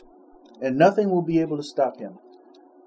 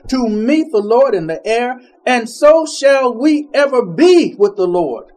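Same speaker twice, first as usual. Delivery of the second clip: scripture reading; 3 semitones higher, 7 dB louder than usual. Acted. Preacher reading Thessalonians to the church, slow and swelling. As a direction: build the verse up, then land it gently. Reads scripture passage aloud.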